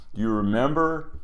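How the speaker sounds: ordinary talk, nothing marked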